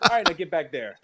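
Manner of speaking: ordinary talk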